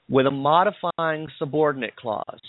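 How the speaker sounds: ordinary talk, nothing marked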